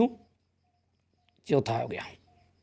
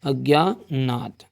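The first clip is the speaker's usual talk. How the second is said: अज्ञानात slowly, split into long syllables; slow dictation; 3 semitones lower, much slower than usual. Real, not acted. Dictates the answer while writing it. (अज्ञानात को तोड़कर, धीरे लिखवाते हुए)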